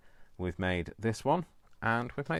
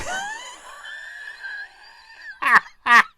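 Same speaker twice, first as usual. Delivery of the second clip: loud, high-pitched cackling laugh; real shrieking fit of laughter; helpless laugh; 13 dB louder than usual. Real, not acted. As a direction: burst out laughing uncontrollably. Helpless laughing fit at own mistake.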